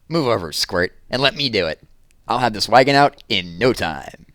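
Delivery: hint of a cocky goat; billy goat's voice. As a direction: brush the little dog aside with a boast. Billy goat speaks in story.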